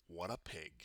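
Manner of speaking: usual speaking voice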